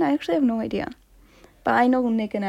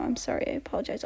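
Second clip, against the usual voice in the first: hushed; 11 dB quieter, faster than usual. Real, not acted. Hushed apology after singing.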